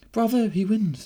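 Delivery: high, loud princess cheer; delighted exclamation. A narrator's reading. Princess cheering the winning ticket, delighted exclamation. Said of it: "the princess exclaimed".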